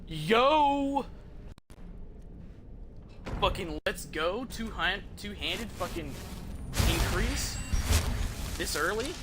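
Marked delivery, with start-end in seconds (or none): none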